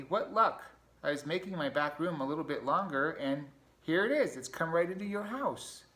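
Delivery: Ratty beaming after breaking through the wall. Acted. Ratty delighted with his new tunnel, high and eager.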